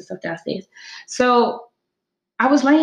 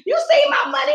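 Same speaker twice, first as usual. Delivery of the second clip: high squeaky mock question; mock high squeak; 3 dB louder, faster than usual. Quoting herself searching the garbage, in a high mock squeak. Asking in garbage, quoted self.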